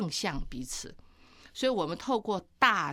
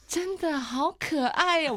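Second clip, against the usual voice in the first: tender coo; 8 semitones higher, 3 dB louder, slower than usual. Retold or imitated. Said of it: "我就说".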